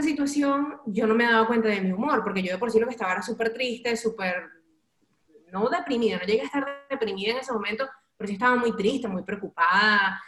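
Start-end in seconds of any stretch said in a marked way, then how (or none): none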